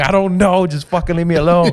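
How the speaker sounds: high-pitched mock exasperation; exasperated outburst. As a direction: act out a fed-up, whiny protest. Acts out exasperated answer to relatives.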